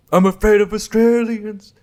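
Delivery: exaggerated comic whine of fright; mock fearful voice. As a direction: ham up the fear, pitched right up. Repeats line in put-on voice.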